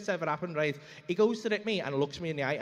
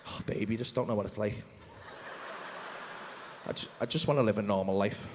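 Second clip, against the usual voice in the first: low, soft, put-on celebrity sigh; mock long-suffering; 12 semitones lower, 4 dB quieter than usual. Star to wife who says nobody cares, mock long-suffering. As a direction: sink low as a self-pitying star.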